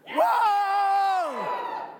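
full-throated whoop of joy; cheering whoop for the congregation; big shout. Shown as a demonstration. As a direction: holler as loud as you can. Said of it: "shout at the top of your lungs".

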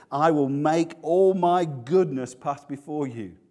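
slow, loud, ringing declaration; emphatic proclamation. Acted. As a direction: proclaim it slowly with full weight. Emphatic repeat of quiet quote.

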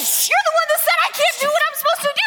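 high, fast, panicked accusations. Acted. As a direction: shriek it out in a panic. Medium panics, accusing King Saul.

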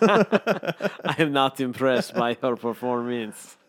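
teasing the co-host with his own words in a put-on voice; mocking echo. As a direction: parrot it back slowly to tease. Mocking back the co-host's line.

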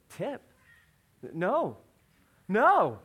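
imitated refusal building from normal to a high, loud cry; mock child working up to a scream; rising toddler panic. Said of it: "And starts to scream".

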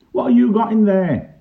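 high, loud challenge; questioning policeman. A narrator's reading. Pongo the bobby challenges a man at the gate, high and loud. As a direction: call it out as a nosy policeman.

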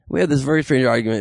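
ordinary talk, nothing marked

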